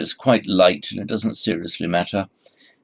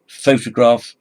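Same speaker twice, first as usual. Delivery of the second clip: photograph slow, with a loud PHO; PHO-tograph, first syllable strong; stress on first syllable; 5 semitones higher, 9 dB louder than usual. Shown as a demonstration. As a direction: contrast by punching PHO in photograph. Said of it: "So it's the stress".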